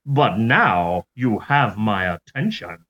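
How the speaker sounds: low mock Southern accent, r's dropped; hammed-up Southern accent. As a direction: play the Southern gentleman letter-writer, deliberately paced. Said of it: "I'm giving him a Southern accent".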